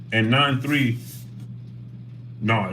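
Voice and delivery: ordinary talk, nothing marked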